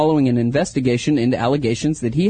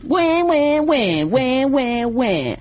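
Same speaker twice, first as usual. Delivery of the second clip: sung wah-wah of a sad trombone, high then sinking; 9 semitones higher, much slower than usual. Pretend. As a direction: hum a comic losing-horn fanfare. Sad trombone before sad story.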